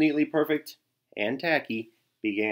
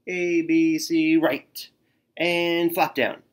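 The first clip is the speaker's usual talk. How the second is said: louder, higher cheering; 5 semitones higher, 6 dB louder, much slower than usual. A narrator's reading. Tacky doing his own cheer, louder than the team. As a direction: belt out the cheer.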